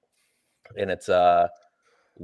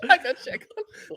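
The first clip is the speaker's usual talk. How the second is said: giggling, much higher; laughing. Real, not acted. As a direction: laugh through the words. Laughing at own obsession.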